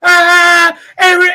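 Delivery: high, loud mock shriek; hammed-up terror; frightened captain shrieking in mock panic; panicked shriek. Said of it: "you're pissing yourself the first time".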